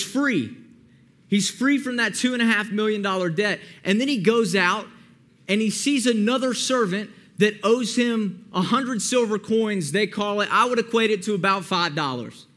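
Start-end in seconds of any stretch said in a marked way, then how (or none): none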